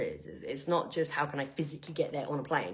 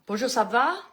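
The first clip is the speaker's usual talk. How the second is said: French greeting; 5 semitones higher, 7 dB louder than usual. Shown as a demonstration. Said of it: "'Cause I can do".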